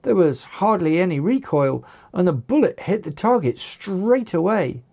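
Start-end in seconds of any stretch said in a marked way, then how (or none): none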